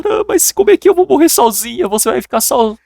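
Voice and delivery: very high, hammed-up tearful wail; mock crying. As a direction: play the weeping wife pleading. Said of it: "ela começou a chorar".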